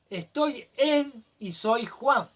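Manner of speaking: "Estoy en y soy Juan" lento y remarcado palabra por palabra; dictándolo despacio como modelo; forma correcta, pausada. Shown as a demonstration. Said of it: "Esa es la forma correcta". (modelo correcto dicho despacio y claro)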